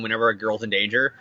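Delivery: ordinary talk, nothing marked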